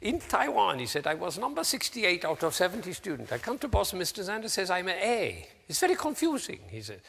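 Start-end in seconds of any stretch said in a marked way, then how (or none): 0.0-0.8 high-pitched imitation of a Taiwanese student's accent. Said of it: "Forgive me for my attempt at his accent". 1.1-6.6 high-pitched imitation of a Taiwanese student's accent. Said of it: "Forgive me for my attempt at his accent".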